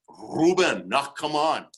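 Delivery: put-on tough Austrian coach accent; Arnold Schwarzenegger. Coach answering luger by radio, Austrian accent. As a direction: Austrian accent, punching the name loudly. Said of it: "sounds like Arnold Schwarzenegger".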